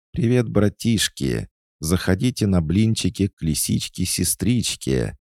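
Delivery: high, sing-song fox voice; fox mascot greeting. Acted. Creepy fox doll inviting visitors for pancakes, cheery. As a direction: lure guests in like a fairy-tale fox. Animatronic fox calls guests in.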